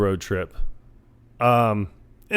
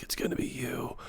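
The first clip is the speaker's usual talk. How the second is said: creepy whisper; 16 dB quieter than usual. Retold or imitated. Barry holds the mouse and tells it it's chosen, whispered. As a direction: whisper it like an ominous promise.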